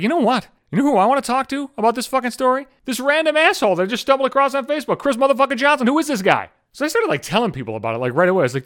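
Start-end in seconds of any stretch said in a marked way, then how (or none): 0.0-6.5 a lightly voiced snooty journalist, higher; mock the uptight reporter, higher pitched; stuffy reporter. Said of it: "some fucking stuffy asshole from the fucking Wall Street Journal".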